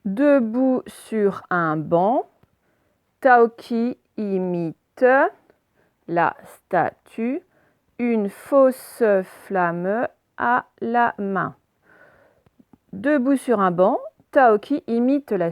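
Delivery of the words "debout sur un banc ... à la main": slow deliberate reading. Shown as a demonstration. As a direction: read very slowly, word by word. Teacher models slow word-by-word reading.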